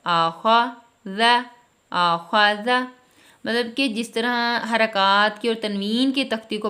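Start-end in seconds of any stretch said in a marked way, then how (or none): 0.0-2.8 loud, slow syllable sounding; sounded-out syllables. Shown as a demonstration. Teacher showing parents how to sound words out, slow blending. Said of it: "آپ اس طرح پڑھائیں".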